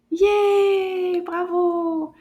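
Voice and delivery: long, high, loud celebratory cheer. Real, not acted. Teacher praising children for finishing the word, high drawn-out cheer. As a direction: draw it out in a big high hooray.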